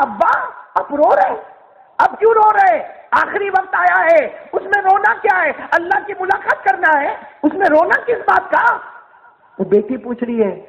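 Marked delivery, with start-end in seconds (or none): 0.0-8.8 high-pitched, insistent questioning; a preacher voicing a daughter's urgent appeal; pleading daughter. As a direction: press each question high and forcefully. Daughter questions her weeping father.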